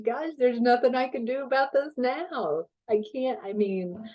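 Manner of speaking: high, giggly words through laughter; laughing helplessly. Retold or imitated. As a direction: shrug it off while cracking up. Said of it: "I'm laughing so hard".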